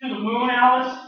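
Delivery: loud comic catchphrase; Jackie Gleason quote. Retold or imitated. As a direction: bellow it like the TV line.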